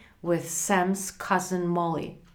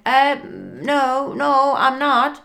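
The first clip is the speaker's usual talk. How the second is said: higher, louder, hesitant start then firm; flustered refusal; 6 semitones higher, 7 dB louder than usual. Acted. Frank refuses the bus driver, flustered and emphatic. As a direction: back out of it in alarm.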